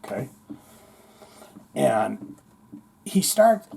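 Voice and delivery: usual speaking voice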